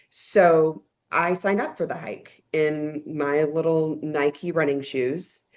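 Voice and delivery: ordinary talk, nothing marked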